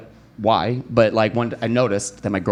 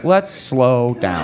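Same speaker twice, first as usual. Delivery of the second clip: very slow, drawn-out words; slowing right down; much slower than usual. Retold or imitated. Man halting girlfriend's talk of male ghost, very slow. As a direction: stretch each word to halt her. Wary reply to male presence remark.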